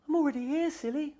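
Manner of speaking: very high squeaky mouse voice; squeaking. Acted. Mouse answering Rabbit, very high squeaking. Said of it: "Mouse squeaked".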